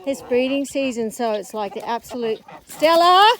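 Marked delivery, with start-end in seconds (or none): 2.7-3.3 calling out. Real, not acted. Calling the dog across field.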